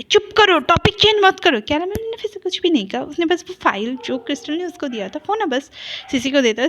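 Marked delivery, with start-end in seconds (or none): 0.0-1.6 angry outburst. A narrator's reading. Sister's angry retort.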